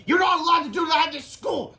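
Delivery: high, loud snap; snapped. Acted. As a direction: snap at the boys. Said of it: "snapped Miss Ribble".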